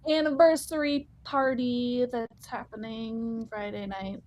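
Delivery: ordinary talk, nothing marked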